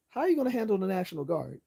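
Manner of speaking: high, fast, incredulous question. Real, not acted.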